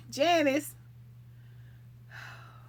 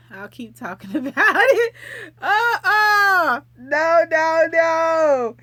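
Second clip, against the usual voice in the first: loud, high laugh-cry protest; laughing through tears; 7 semitones higher, 8 dB louder, slower than usual. Real, not acted. To viewers teasing about the show, a loud laugh-cry no. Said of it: "Y'all like to see me cry".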